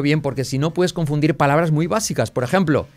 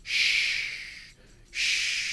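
long voiceless shush; soft sustained "shh"; shushing sound; 10 dB quieter than usual. Shown as a demonstration. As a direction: shush someone with a drawn-out breath. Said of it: "Es como cuando mandas callar a alguien".

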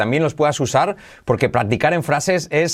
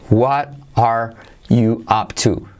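word by word, slow and separated; slow model sentence; slower than usual. Shown as a demonstration. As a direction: leave a gap after each word. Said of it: "Mira cómo lo pronuncio". (despacio, palabra por palabra)

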